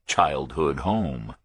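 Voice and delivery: ordinary delivery, nothing marked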